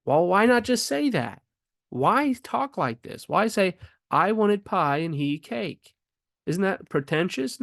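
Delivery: high-pitched, incredulous questioning; challenging objection. Acted. Teacher voicing an objection to the elliptical sentence, challenging. Voices an objector to the grammar rule.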